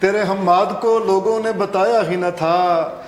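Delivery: projected, measured Urdu verse; ghazal recitation. Acted. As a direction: declaim the couplet phrase by phrase. Guest recites his ghazal.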